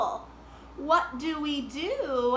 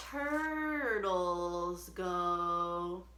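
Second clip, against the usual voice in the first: drawn-out slow turtle voice; slow, hushed turtle sound; 6 semitones lower, 7 dB quieter, much slower than usual. Shown as a demonstration. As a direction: stretch every sound like a turtle. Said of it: "sing really slow for the turtles".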